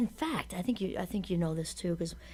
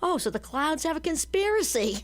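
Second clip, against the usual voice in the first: higher, mock Brooklyn accent; light Brooklyn accent, teasing; 9 semitones higher than usual. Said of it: "Loretta has this, like, Brooklyn accent".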